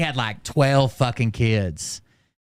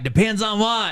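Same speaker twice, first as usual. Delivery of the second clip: curious coworkers; 11 semitones higher than usual. Retold or imitated. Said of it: "And they're like".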